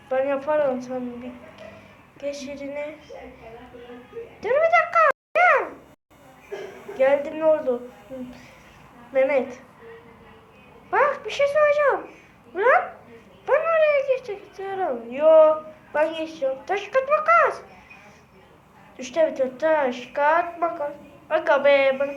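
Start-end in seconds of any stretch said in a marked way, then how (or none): 4.4-5.6 squeaky little character boasting about winning; high cartoon kid voice. Character bragging to his rival, squeaky cartoon voice. 10.9-15.0 squeaky little character boasting about winning; high cartoon kid voice. Character bragging to his rival, squeaky cartoon voice. 16.7-17.6 squeaky, quick rock-paper-scissors chant; high cartoon kid voice. Character playing rock paper scissors, squeaky voice.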